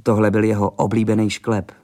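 plain reading voice

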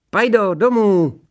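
high, loud, drawn-out call. A narrator's reading. Boy calling his dachshund back home, loud high call.

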